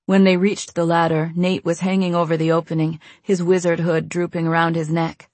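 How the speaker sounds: usual speaking voice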